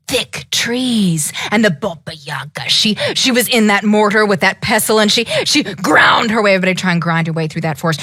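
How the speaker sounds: plain reading voice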